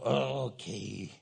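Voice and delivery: slow, low, reluctant giving in; grudging surrender. Acted. Husband giving in after God scolds him, slow grudging okay. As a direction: drag it out, low and unwilling.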